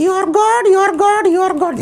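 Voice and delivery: put-on puppet boy voice. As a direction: play the cheeky little boy puppet. Puppet mocks uncle's exclamation.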